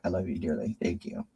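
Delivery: thanks fading into tears; tearful thanks. Real, not acted. Thanking a devoted viewer, voice breaking with tears. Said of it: "now you're gonna make me cry".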